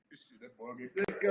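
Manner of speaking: breathy whisper of dread; hushed whispered gossip; whispered aside. Retold or imitated. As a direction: whisper it like the room can hear. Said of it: "we said".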